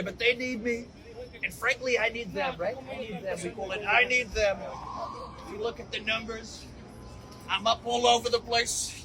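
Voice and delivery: rally-stage Trump, self-congratulating; Trump impression. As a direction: brag to the crowd like a showman president. Trump show, Mister Trump.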